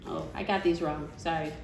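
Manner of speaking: usual speaking voice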